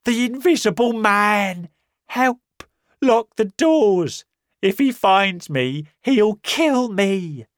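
high, loud, slow desperate cries; terrified pleading. Acted. Marvel begging the men to lock the doors, terrified. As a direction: beg them to lock the doors. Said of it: "he shouted".

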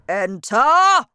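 booming, menacing bark of a fierce headmistress; loud and dangerous. A narrator's reading. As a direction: bellow it like a bully guarding her office. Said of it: "in a loud and dangerous voice".

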